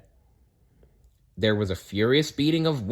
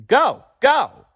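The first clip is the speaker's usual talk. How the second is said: high loud shout; 8 dB louder than usual. A narrator's reading. Fox shouting from the roadside, high and loud. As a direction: urge them across. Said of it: "Fox shouted from the roadside".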